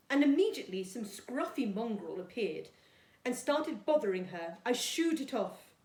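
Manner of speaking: put-on storytime reading for young children; gentle storybook read-aloud for Year two; reading a story. A narrator's reading. Teacher reads story aloud to class.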